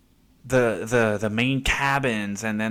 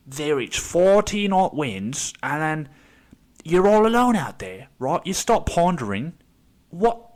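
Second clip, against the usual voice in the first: put-on British accent; 5 semitones higher than usual. Old British sailor on a documentary, British accent. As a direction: play an old BBC sailor recounting the voyage, British accent. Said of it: "doing a British accent".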